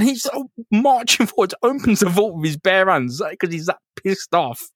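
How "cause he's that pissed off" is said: usual speaking voice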